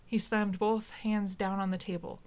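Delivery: ordinary delivery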